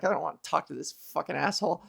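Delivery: muttered fast and low, fed up; sighing disgust. Retold or imitated. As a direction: grumble it under your breath in disgust. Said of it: "she's already sighing".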